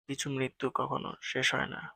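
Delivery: usual speaking voice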